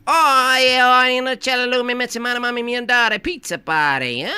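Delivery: sing-song pretend Italian preacher; mock Italian tongues. Speaking in tongues at a Pentecostal church, high mock Italian. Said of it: "my tongues sound exactly like Italian".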